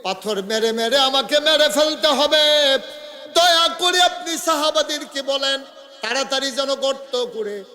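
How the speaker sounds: loud, high chanted pleading; impassioned plea. Acted. Woman begging the Prophet to have her stoned, impassioned plea. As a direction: plead at full preaching force.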